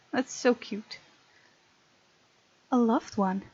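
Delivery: ordinary talk, nothing marked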